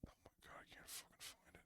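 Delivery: real frustrated whisper while searching; whispering to himself. Real, not acted. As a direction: breathe it out, barely voiced. Searching for the tweet under breath.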